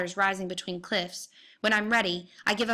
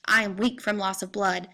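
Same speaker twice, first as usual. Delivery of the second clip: usual speaking voice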